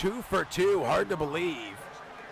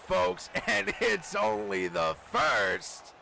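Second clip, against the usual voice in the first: ordinary delivery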